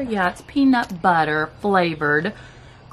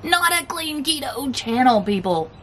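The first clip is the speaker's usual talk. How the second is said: higher, know-it-all quoted critic; mock critic; 7 semitones higher than usual. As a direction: mimic a preachy keto commenter. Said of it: "I can hear it now".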